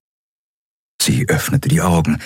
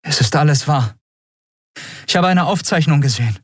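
high, earnest character voice; Endriel, insistent; 8 semitones higher than usual. Acted. Endriel tells Keru it is all true, insistent. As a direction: urge him to believe it, pitched up.

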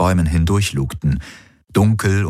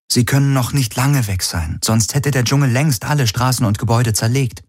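higher-pitched character voice, matter-of-fact; Efi, reasoning companion; 6 semitones higher than usual. Acted. Voices Efi in narrated story. (sachliche, höhere Figurenstimme)

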